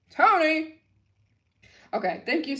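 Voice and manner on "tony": higher, louder call of the name. Real, not acted. Reading donations, she calls out to a donor, loud call. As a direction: call the name out across the room. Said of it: "Okay, Tony".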